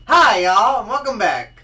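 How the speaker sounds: loud, high host greeting; show intro. Acted. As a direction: call out a big welcome to the audience. Show intro called out on retake.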